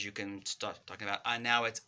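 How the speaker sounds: usual speaking voice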